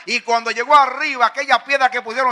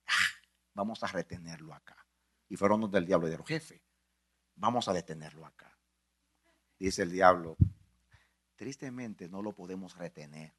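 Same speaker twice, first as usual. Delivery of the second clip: hushed sermon storytelling; 8 semitones lower than usual. Acted. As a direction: draw them in with a low hushed telling. Hushed retelling of the tomb.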